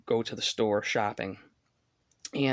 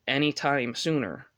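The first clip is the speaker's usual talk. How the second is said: normal talking voice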